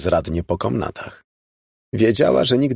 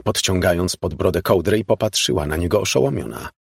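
usual speaking voice

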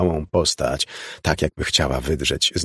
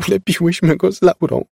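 high, loud tearful confession; pitiful sobbing; 11 semitones higher, 5 dB louder than usual. A narrator's reading. Elizabeth admits she and Laura built it, sobbing. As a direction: wail it miserably. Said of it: "szlochała żałośnie Elizabeth".

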